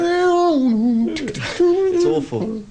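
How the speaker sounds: high, very slow drawn-out groan of disgust; mocking disgust. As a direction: stretch it out, pulling a face. Describing a terrible remix.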